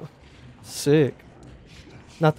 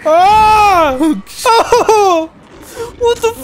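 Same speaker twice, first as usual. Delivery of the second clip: shrieking in real fright; startled scream; 6 dB louder, much slower than usual. Real, not acted. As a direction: scream it, truly scared. Jump scare in game.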